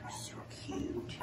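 breathy little whisper. Real, not acted. Admiring a pup, soft whisper. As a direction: sigh it out softly. Whispering over newborn puppies.

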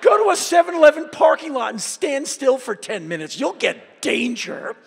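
mock outraged shout; incredulous rant. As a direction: yell it like you can't believe them. He rants back at officers.

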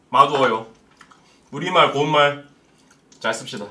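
chewing while talking; eating noodles between words; mouth full. Real, not acted. Seen 0: small figure eats noodles with chopsticks, cup at mouth while talking.

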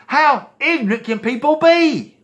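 high, exasperated outcry. Real, not acted. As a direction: throw the question up in disbelief. Exasperated rant at NIV readers.

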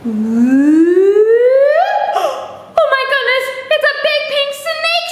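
high, gasping pretend shock; mock surprise. Teacher hamming surprise at a pink snake for kids. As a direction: gasp and squeal as if startled. Acting surprise at book puppet.

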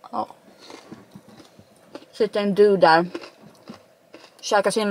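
talking between chews; words muffled by food; mouth full, chewing. Real, not acted. Seen 0: Bite taken at -2; cheeks full and chewing through early words.

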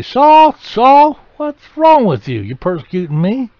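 booming high shout of Saul's name; mock thundering voice. Jesus hurling a thunderous rebuke at Saul. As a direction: yell it from heaven, over the top. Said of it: "I don't think he did it".

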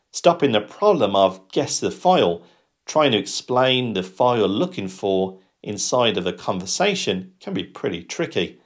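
ordinary delivery

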